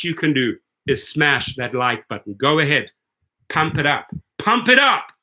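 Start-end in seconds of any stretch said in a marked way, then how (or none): 4.4-5.1 higher, louder hype call; rally the viewers with a punchy lift; energetic cheerleading push; pumped-up urging. Real, not acted.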